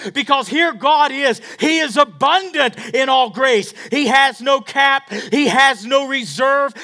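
loud, high, impassioned sermon; preaching at full force. Acted. Pastor preaching to the congregation, fervent and loud. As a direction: preach it out with fire.

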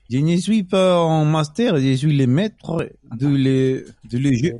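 pompous cartoon French chef, drawn out. Mock French as game master.

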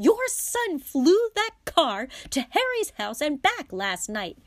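high, loud, furious mother; outraged wife's shrieked scolding; shouting. A narrator's reading. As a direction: shout the accusation over his chatter. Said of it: "shouted Missus Weasley".

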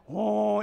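short loud cartoon "Wah"; cartoon anger wail. Preacher mimicking the cartoon rage noise again. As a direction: blurt the cartoon rage sound. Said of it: "that old, uh, character".

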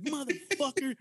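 high-pitched cursing while stomping; hammed-up furious outburst; mock rage. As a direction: curse it out between stomps. Acts out stomping on the hornet.

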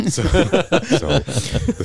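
slow words through real laughter; laughing. Real, not acted. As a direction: laugh helplessly while trying to start. Laughing at the nickname teasing.

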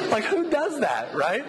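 can't believe it, laughing as you say it; laughing in disbelief. Real, not acted. Amazed at the friend's reply.

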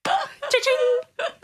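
high laughing cha-ching; cash register cha-ching. Acted. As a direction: ring it up like a till. Mock cash register for plug.

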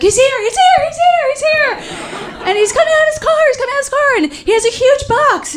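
put-on very high, squealing kids relaying news; frantic high kids' whispered-shout chorus. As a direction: chirp it like little kids in unison. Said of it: "all eight of us have to repeat it".

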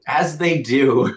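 very slow, stretched words; drawn out slowly. Shown as a demonstration. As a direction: stretch each word out knowingly.

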